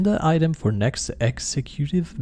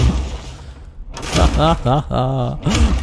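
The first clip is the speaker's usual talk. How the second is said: evil laugh; 4 dB louder than usual. Pretend. Laugh during villain boast.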